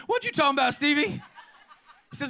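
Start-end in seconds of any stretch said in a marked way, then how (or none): 0.1-1.1 high, animated mother's question. Retold or imitated. Preacher as mother questioning young Stevie, high comic challenge. Mother's line again, after the aside.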